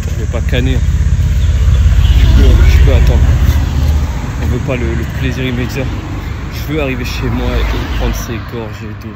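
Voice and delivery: loud self-encouraging shout. Real, not acted. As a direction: yell to keep going. Pushing through the run.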